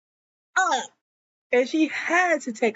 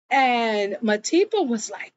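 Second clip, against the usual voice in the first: usual speaking voice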